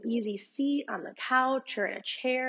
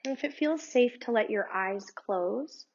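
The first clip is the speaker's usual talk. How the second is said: calm, unhurried meditation guide voice; guided meditation; 3 dB quieter than usual. Acted. Yoga teacher guiding her students through meditation, calm and unhurried. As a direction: lead the listener gently through breathing.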